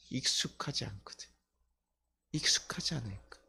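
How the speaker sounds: voiceless confiding whisper; hushed, breath-only explanation; whispered aside. Real, not acted. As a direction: whisper it like an admission.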